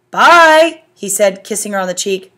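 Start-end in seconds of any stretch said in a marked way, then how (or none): 0.1-0.8 bye. A narrator's reading. Dad says bye.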